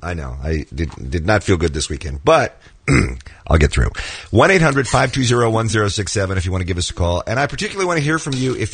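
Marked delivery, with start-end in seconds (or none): 0.0-3.9 low voice of someone under the weather; rough, sick voice. Real, not acted. Sick host answering co-host's remark, low and rough. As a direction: get through it sick, pitched well down. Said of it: "You sound rough".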